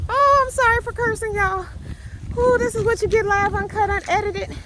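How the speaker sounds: very high, loud, nervous apology; half-laughing, voice still way up from the scare; shaken, loud laughing apology. Real, not acted. Rattled vlogger apologising to viewers for cursing, very high and loud.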